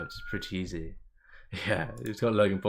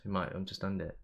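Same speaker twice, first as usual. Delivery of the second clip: ordinary talk, nothing marked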